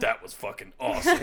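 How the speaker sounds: excited. Retold or imitated. Acted delight after sex.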